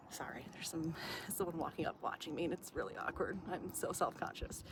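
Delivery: hushed, unvoiced aside; whisper. Real, not acted. A passerby watches her film, so she whispers self-consciously. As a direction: drop to a whisper so nobody hears.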